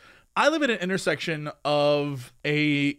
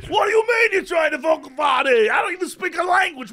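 put-on high, shouted New York accent; New York guy; 10 semitones higher, 4 dB louder than usual. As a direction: argue back as a baffled, irate New Yorker. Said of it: "my New York guy".

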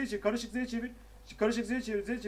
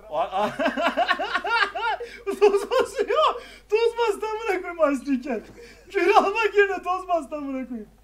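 high, loud helpless laughter through words; laughing to tears; 8 semitones higher, 3 dB louder than usual. Real, not acted. Player cracking up as dust covers the fight, laughing till crying. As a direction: wheeze the words out while cracking up. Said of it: "Ağladım gülmekten".

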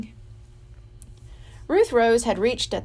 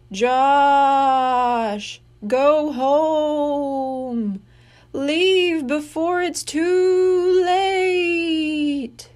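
very slow, louder wailing ghost warning; ghostly moan; 5 semitones higher, 4 dB louder, much slower than usual. Acted. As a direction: draw out the warning like a spirit in the hall. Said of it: "This time it moaned".

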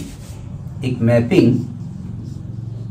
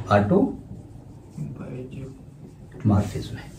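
much softer, slow, trailing off; softened repeat; 3 semitones lower, 13 dB quieter, much slower than usual. Shown as a demonstration. Teacher softly repeating the term, slow and quiet. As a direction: murmur it for the notebook. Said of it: "repeating term for notes".

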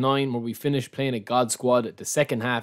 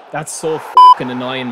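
1 angry outburst; 2 semitones lower, 11 dB louder than usual. Real, not acted. Gamer fuming after conceding goal.

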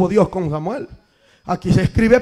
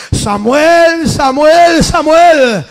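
louder, higher call repeated; 5 semitones higher, 7 dB louder, much slower than usual. Acted. God calling young Samuel in the dark, a raised call. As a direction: call the boy's name across the night.

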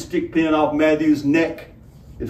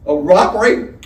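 incredulous; 5 semitones higher, 7 dB louder than usual. Acted. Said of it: "Straw Hat sounded incredulous".

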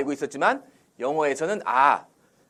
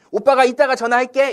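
higher, louder quoted promise; acted everyday promise; 8 semitones higher, 7 dB louder than usual. Older brother promises to phone later, higher and louder. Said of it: "이런 말 많이 하죠".

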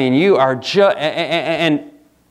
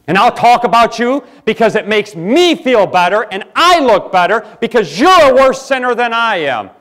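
higher, louder, puffed-up voice of a gossip; mock gossiping sinner; 9 semitones higher, 6 dB louder than usual. Preacher mocks a gossiping sinner to the congregation, in a higher, louder voice. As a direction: sneer it like a self-righteous gossip.

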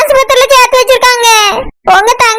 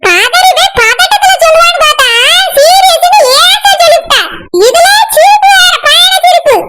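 higher-pitched nagging mother voice; higher, shouting old mother; scolding mother; 6 semitones higher than usual. Acted.

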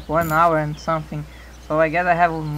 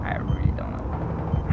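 whispered; 9 dB quieter than usual. Real, not acted. Repeats it under his breath.

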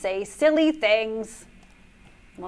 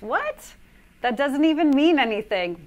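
usual speaking voice

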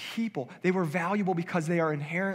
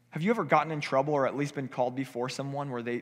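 ordinary talk

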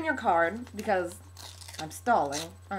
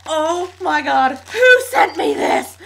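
freaked-out exclamation; 3 semitones higher, 9 dB louder than usual. Retold or imitated. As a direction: reenact shrieking in disbelief. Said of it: "I opened it and I was like".

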